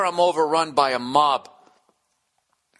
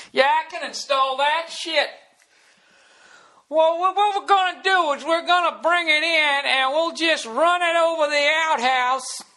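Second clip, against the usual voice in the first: backwoods installer. Acted. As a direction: play a rural installer who's all confidence.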